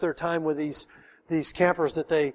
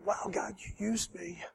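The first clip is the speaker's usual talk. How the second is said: much softer, hushed wonder; awed whisper-quiet; 2 semitones higher, 12 dB quieter than usual. Retold or imitated. Pastor marvelling to God, soft and hushed. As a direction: breathe it out in awe.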